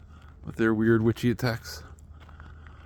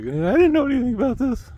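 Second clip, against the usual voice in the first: much higher, louder haunting wail; 14 semitones higher, 8 dB louder than usual. Retold or imitated. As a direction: wail it like a ghost. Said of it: "like, haunting".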